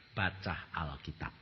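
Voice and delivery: low, soft, slow "Baca Alkitab"; God's quiet command. Retold or imitated. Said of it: "Tuhan berkata". ("Baca Alkitab" pelan, lirih dan rendah)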